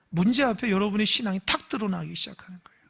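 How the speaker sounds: hushed whisper to the congregation; whispered sermon aside. Real, not acted. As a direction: lean in and whisper the point. Preacher drops to whisper.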